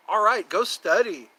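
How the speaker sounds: Ali G impression. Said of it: "like Ali G".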